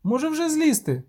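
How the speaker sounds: put-on high-pitched girl's question; little girl. A narrator's reading. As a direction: ask in a high child's voice. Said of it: "захована в листі дівчинка".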